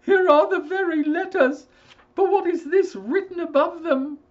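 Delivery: high, loud father voice; an agitated father reading the letter; alarmed old father. Acted.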